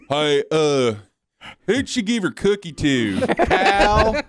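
ribbing a co-host with a put-on Southern accent; mock Southern accent. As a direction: mimic your co-host in a broad Southern accent, half laughing. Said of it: "a Southern accent toward me".

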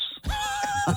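high-pitched laugh; brief burst of high laughter. Real, not acted. Caller says welcome back sweetheart, host laughing.

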